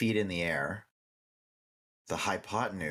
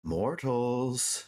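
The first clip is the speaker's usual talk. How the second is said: slow, lightly voiced curious unicorn; a unicorn greeting mortals slowly; gentle unicorn voice; much slower than usual. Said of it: "the unicorn voice".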